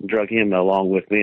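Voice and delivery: ordinary talk, nothing marked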